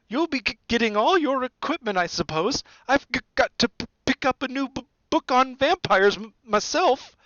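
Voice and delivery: high, stuttering, fearful professor; frightened stammer. A narrator's reading. Quirrell dreading a book on vampires, high stammer. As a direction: catch on consonants in rising dread. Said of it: "He looked terrified".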